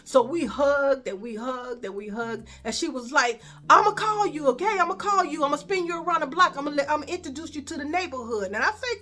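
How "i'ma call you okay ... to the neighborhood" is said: higher, louder eager promises; eager cousin. Retold or imitated. Cousin promising to show her around, fast and eager. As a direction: rattle off plans, fast.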